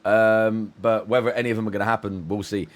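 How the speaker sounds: usual speaking voice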